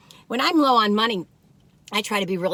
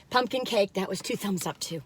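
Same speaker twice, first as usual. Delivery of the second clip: normal talking voice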